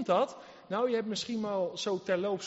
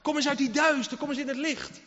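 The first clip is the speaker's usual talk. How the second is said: higher and louder, urging them out of the dark; rising plea; 6 semitones higher, 4 dB louder than usual. Acted. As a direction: build the call to come into the light. Building altar-call appeal. (steeds luider, hoger smeken)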